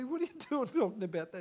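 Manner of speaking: high-pitched retold parental questioning; baffled parent. Parents querying their children's talk, baffled parent.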